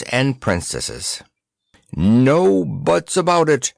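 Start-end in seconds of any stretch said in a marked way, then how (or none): 2.0-3.7 indignant roar. A narrator's reading. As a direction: roar the objection down. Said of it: "roared the King's Head indignantly".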